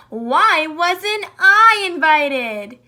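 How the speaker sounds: slow, drawn-out complaining voice; whiny. Acted. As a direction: Jackal whining about not being invited, slow whine. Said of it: "whined Jackal".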